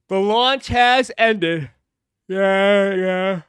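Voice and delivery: talking through a yawn. Real, not acted. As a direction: stretch the words in a yawn. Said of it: "Oh, I'm yawning".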